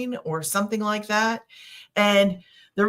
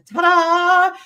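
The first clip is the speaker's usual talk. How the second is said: high, loud "ta-da" reveal; playful fanfare; 10 semitones higher, 7 dB louder than usual. Pretend. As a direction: present it like a magician's big reveal. Showing off the screen to screenshot.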